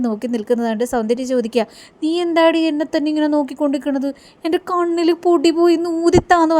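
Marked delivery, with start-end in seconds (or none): 2.0-4.1 higher girlish voice, lightly put on; higher girlish asking why she is being stared at; Soundarya's question. Soundarya asks Paru, voiced. 4.4-6.5 put-on higher childish plea to blow into her eye; Soundarya's plea. Same line repeated, voiced higher.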